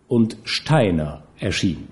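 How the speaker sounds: usual speaking voice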